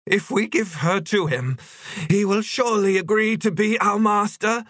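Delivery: very high, otherworldly creature voice; fervent longing. Acted. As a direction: plead greedily in a piping high pitch. Yetcha urging with blazing longing.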